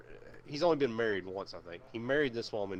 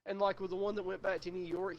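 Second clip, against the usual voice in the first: ordinary talk, nothing marked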